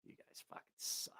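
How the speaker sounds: whispered complaint; whispered grumble. Real, not acted. Streamer whispering an insult at chat after losing. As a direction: whisper it at them sulkily.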